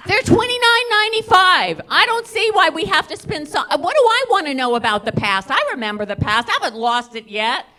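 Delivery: high-pitched haggling outburst; indignant protest. Retold or imitated. Caller arguing with a psychic over the price, indignant.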